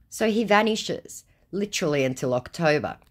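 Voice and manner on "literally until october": ordinary delivery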